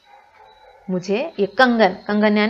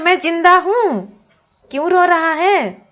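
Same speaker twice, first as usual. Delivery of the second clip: insistent granny; 8 semitones higher, 5 dB louder than usual. Acted. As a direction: reassure her son as granny. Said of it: "बोल रही है".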